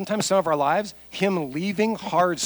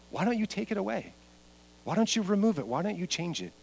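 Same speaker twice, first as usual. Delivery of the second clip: pleading questions; 5 semitones higher, 5 dB quieter than usual. Acted. Acts Paul questioning God in prayer.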